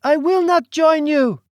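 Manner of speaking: high, loud refusal; defiant shout. Acted. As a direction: hurl the refusal at him. Celine defying the duke.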